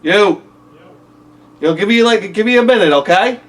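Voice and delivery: shouting to someone downstairs; calling out. Real, not acted. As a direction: call out loud across the house.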